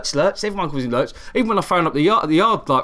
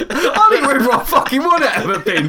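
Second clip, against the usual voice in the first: laughing mock rant; 9 semitones higher, 4 dB louder than usual. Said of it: "I thought".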